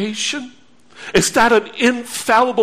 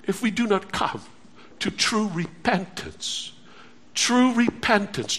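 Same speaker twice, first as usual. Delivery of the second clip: hushed warning; 8 dB quieter than usual. Acted.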